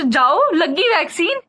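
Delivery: slow, high, disbelieving 'done already?'; drawn out like she can't believe it's over; surprised disbelief. Retold or imitated. Disbelieving patient asking the nurse, slow and high. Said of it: "फिर मैं सोचू".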